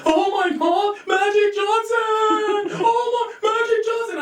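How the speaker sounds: high, squealing starstruck gush. Retold or imitated. Imitating his father meeting Magic Johnson, starstruck squeal.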